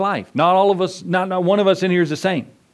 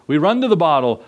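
normal talking voice

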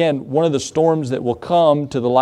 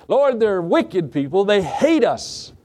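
high, slow mock complaint; reluctant protest; 6 semitones higher, slower than usual. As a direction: whine it slowly, pleading to get out. Voicing Jonah's protest to God.

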